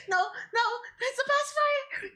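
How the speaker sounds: high squeaky flustered protest. Real, not acted. Flustered protest at pacifier redeem.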